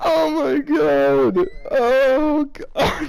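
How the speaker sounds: high squealing laugh, gasping out oh my god; helpless laughter. Real, not acted. Reading the fanfic aloud to a friend, breaking down laughing. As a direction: crack up until you can barely speak.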